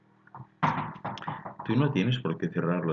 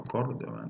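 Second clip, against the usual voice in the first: CÓRdoba, higher on the first syllable; stress on first O; 5 semitones higher than usual. Shown as a demonstration. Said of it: "con el acento en la O". (Córdoba con el acento en la primera O)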